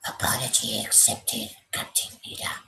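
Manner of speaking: breathy, near-whispered Vader line; a hint of the cold Sith lord; Vader quote. Streamer demonstrates the line for his co-host. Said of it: "You do that one".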